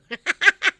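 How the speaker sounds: loud burst of laughter. Real, not acted. Host amused that her yelling was heard, laughing. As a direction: crack up at your own story.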